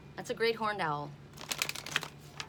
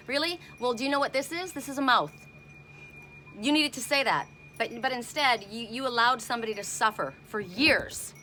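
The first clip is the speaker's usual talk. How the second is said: louder, higher, indignant scolding; angry retort; 3 semitones higher, 4 dB louder than usual. Retold or imitated. Scolding a friend who let someone suffer, louder and higher. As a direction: let the outrage rise as you scold. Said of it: "trying not to, like, lose my cool".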